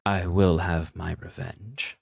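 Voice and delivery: slow, deliberate repeat of a threat; whispered threat, voiced. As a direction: spell out the threat slowly and audibly. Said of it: "hear the light whisper".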